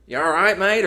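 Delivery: high, loud mock Australian accent; Hugh Jackman impression. Film star greeting a stranger on a bench, mock Australian accent. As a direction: put on a cheeky Australian accent. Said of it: "make fun of his accent".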